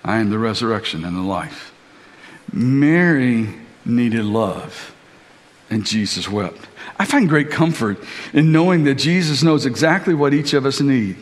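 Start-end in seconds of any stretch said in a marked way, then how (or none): 0.0-1.7 quiet teaching. Retold or imitated. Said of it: "Martha needed a lesson". 2.5-6.7 tender. Real, not acted. Tender sermon climax.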